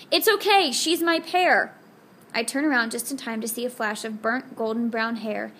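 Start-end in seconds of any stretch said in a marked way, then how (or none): 0.1-1.7 higher and louder, slow, drawn-out call. Acted. Brian calls to the man watching from the street, loud and drawn out. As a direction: call it across the street so he hears. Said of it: "and then calls out".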